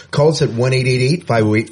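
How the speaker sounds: ordinary talk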